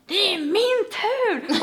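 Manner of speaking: high, loud, drawn-out retold shout; mock yell. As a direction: imitate a man yelling out his complaint. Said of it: "bara skriker bara".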